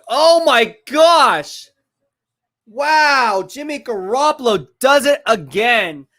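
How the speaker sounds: high, drawn-out exclamation; exasperated disbelief. Real, not acted. Streamer reacting live as Jimmy Garoppolo errs, exasperated disbelief. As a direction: stretch the words out in pained disbelief.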